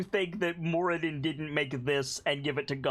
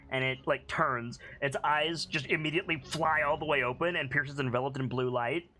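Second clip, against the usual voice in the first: ordinary talk, nothing marked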